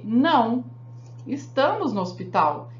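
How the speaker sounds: a firm "Não", clear gap, then "estamos no hospital"; pause after "Não". Shown as a demonstration. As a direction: stop at the comma after "Não" before saying "estamos no hospital". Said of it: "Pra ficar o quê?".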